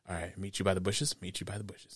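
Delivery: low, hushed rendezvous plan; quiet conspiratorial aside; secretive murmur. Retold or imitated. Voicing a secret arrangement between characters.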